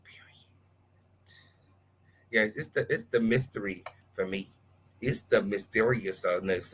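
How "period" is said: barely audible whispered word. Real, not acted. Whispering to viewers, still hushed. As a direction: breathe it out, almost silent. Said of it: "I don't wanna use her name".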